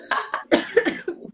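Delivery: hard cough to clear the throat. Real, not acted. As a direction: cough up the bug. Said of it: "Entrou um mosquito dentro da minha garganta". (tosse forte para limpar a garganta)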